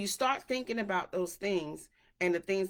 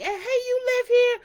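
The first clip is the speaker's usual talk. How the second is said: high, loud mock neighbor greeting; imitating a chatty neighbor; 10 dB louder than usual. To her neighbor at the mailbox, a high loud mock greeting. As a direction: squeal out a pushy hello to a stranger. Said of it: "I'm like".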